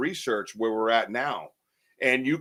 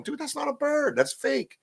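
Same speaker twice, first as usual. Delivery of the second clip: high-pitched disbelief; 14 semitones higher, 4 dB quieter than usual. Retold or imitated. To his friend Wojo about the whistling, high incredulous. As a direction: voice jumps way up, incredulous. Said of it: "you can hear me on the video going".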